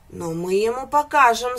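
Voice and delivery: a hint of a horse's neigh. A narrator's reading. Horse threatens the goat, a mild neigh. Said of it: "заржал конь".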